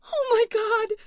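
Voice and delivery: very high, very loud shriek; terrified scream. A narrator's reading. Louise sees the creature coming, terrified scream. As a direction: scream in horror into the phone. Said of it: "screamed into her phone".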